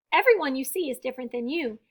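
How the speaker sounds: plain reading voice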